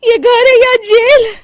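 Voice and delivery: worn-out bride grumbling about her home being a jail; exasperated complaint. Acted. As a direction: vent frustration at the strict house.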